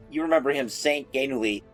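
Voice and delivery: usual speaking voice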